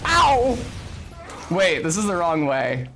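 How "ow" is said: loud, high "ow"; short shouted yelp of pain. Real, not acted. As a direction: yelp as if just hit. Hit during play.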